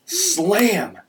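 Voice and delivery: high, drawn-out story crash; crash exclamation. A narrator's reading. Storyteller voicing a pickup sideswipe, high exclamation. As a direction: punch the crash word high and let it fall.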